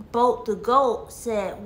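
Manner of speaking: usual speaking voice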